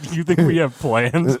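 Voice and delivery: amused disbelief, drawn out; mock incredulous. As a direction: scoff at the idea, slowly. Mocking own lack of plans.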